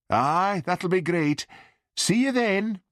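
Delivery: very high mother's sign-off; mother on the phone. A narrator's reading. Mother ending call with son, high voice.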